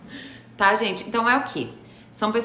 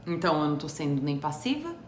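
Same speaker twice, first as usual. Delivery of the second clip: usual speaking voice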